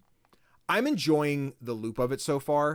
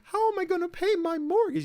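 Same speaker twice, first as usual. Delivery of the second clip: much higher, frantic wailing woman; panicked lady; 4 dB louder than usual. Retold or imitated. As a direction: squeal it like a desperate customer. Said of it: "the scene from The Incredibles".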